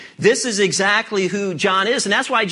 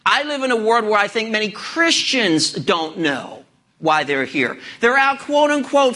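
ordinary talk

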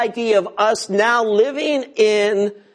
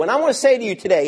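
ordinary talk

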